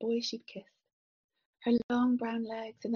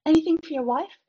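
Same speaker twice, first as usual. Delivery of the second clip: stewardess offering service; 6 semitones higher, 13 dB louder than usual. A narrator's reading. Stewardess calls an offer to passengers.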